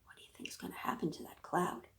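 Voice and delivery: fast conspiratorial whisper. Real, not acted. Librarian asking children what happens next.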